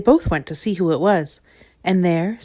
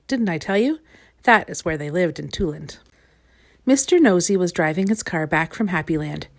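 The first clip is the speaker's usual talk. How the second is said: plain reading voice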